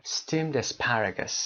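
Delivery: steamed asparagus drawn out and joined; steamed asparagus as one word; slow linked demo. Shown as a demonstration.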